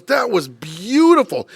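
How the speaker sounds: slow, high, drawn-out admiration; gushing praise. Retold or imitated. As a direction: savour each word like a delighted maestro. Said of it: "He goes".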